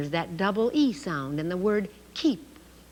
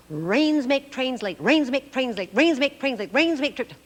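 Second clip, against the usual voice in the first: repeated faster and higher, tripping at the end; speeding tongue twister; 5 semitones higher, 3 dB louder than usual. Shown as a demonstration. Teacher racing through a tongue twister and tripping. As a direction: rattle it off quicker until you stumble. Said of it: "Oh, what a tongue twister".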